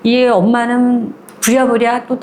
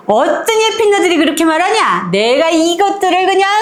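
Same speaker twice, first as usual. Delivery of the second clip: put-on high, fierce old woman's threat; ready to grab them by the hair; threatening grandmother; 8 semitones higher than usual. Grandmother vowing to deal with gossiping women, fierce.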